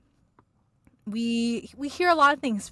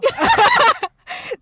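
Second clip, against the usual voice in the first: high squealing laugh; high burst of laughter; 14 dB louder than usual. Real, not acted. Laughs at own mistake.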